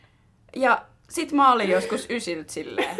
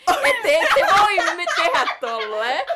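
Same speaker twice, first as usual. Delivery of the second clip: higher, louder mock yell, laughing through it; retold shout; 10 semitones higher, 7 dB louder, slower than usual. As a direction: imitate them calling out across the bar, half laughing. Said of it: "mut sit ne huus meille sieltä". (naurahtava matkiva huuto)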